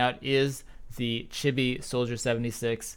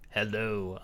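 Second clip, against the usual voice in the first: a softer, lower everyday hello; non-casting voice; 4 semitones lower, 6 dB quieter than usual. Shown as a demonstration. Caster demos his off-air voice to co-caster, softer plain hello. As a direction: say it like you're off the mic. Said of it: "That's my non-casting voice".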